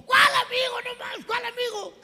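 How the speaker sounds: very high, loud shout; hammed-up incredulous yell; outraged father. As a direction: explode into a squealing demand. Father outraged about the friend.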